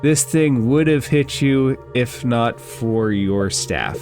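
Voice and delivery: ordinary delivery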